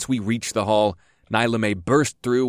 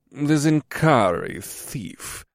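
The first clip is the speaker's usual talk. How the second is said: oily voice; 5 semitones higher, much slower than usual. A narrator's reading. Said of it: "in an oily voice".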